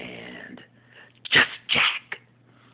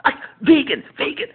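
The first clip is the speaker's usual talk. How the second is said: higher, louder flamboyant sitcom voice; Jack impression; 10 dB louder than usual. Jack telling Will about his crisis, high and loud. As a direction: burst in with drama. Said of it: "impersonation of ... Just Jack".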